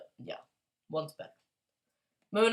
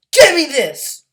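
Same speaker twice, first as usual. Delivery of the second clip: shout; 7 semitones higher, 16 dB louder than usual. Real, not acted.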